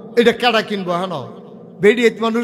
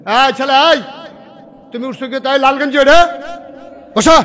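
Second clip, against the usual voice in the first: calling out sharply; 5 semitones higher, 5 dB louder than usual. Real, not acted. Preacher calling out to a boy standing up, loudly. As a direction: raise the voice to single him out.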